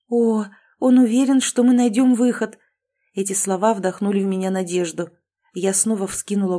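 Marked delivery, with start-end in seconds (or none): 0.1-2.6 higher and louder hopeful echo; excited. A narrator's reading. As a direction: burst of hope, lift the voice. Heroine's relieved echo.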